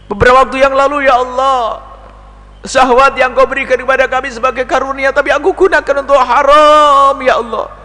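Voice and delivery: high, pleading confession to God; confessing prayer. Acted. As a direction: confess aloud, begging. Voiced confession prayer continues.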